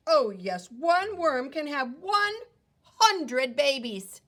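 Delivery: loud, slow, proud worm. Acted. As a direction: boom it out proudly, stretching hundred.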